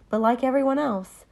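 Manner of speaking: ordinary talk, nothing marked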